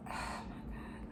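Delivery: whispered, weary "oh my God"; hushed disbelief; exasperated whisper. Real, not acted. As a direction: sigh it out under your breath. Exasperated aside to Sheree.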